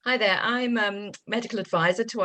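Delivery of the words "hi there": ordinary delivery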